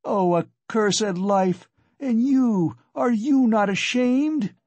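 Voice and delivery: slow, high-pitched despairing accusation; drawn-out desperate outcry, rising in pitch; anguished reproach. A narrator's reading. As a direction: cry out in bitter reproach. Frantic wife turns on the visitor.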